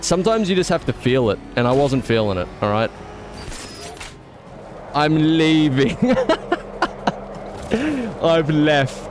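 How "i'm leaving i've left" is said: laughing. Real, not acted. Cracking up at his own bit.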